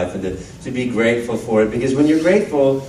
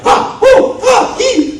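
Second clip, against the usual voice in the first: high, loud breathy squeals and gasps; gaming yelps; 6 dB louder, much slower than usual. Retold or imitated. Being filmed while gaming, high loud squeals. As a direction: squeal like the controller got away from you. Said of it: "videotape me playing the games because I'm like".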